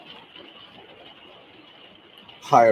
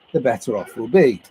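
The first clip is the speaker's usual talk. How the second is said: ordinary delivery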